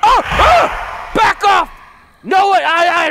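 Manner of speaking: high, loud terrified yells; panicked screaming. Real, not acted. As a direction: scream as it lunges at you. Monster attacks.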